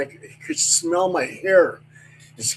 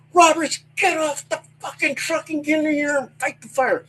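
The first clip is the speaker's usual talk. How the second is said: high yelled order, swearing; captain yelling; 10 semitones higher than usual. Retold or imitated. Captain ordering Roberts off the truck, high yelled order. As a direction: roar it from below the truck. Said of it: "And he goes".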